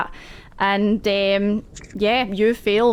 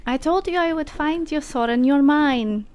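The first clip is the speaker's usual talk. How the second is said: higher, taunting villain voice; menacing mentor; 4 semitones higher than usual. Acted. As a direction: gloat over the captured pupil.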